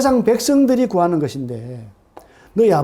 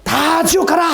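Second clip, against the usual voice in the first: high mock shout; hammed-up condemnation; mock harsh God; 9 semitones higher, slower than usual. Preacher mocking a harsh God condemning people. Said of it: "이렇게".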